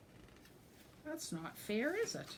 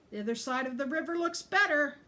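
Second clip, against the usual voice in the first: a higher, louder whiny complaint; complaining; 7 semitones higher, 7 dB louder than usual. Acted. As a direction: goat grumbles about the other side, higher louder complaint. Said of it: "complained Gruff".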